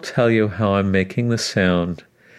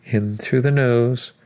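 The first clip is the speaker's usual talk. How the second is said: guided breathing cues; 3 dB quieter than usual. Acted. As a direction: count the breaths gently, unhurried.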